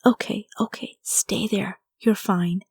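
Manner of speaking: hushed, breathy soothing to a scared mop; whispered reassurance, barely voiced. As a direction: coax the mop gently in a whisper. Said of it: "I whispered".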